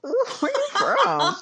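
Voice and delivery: laughing tease. Real, not acted. Teasing about her accent.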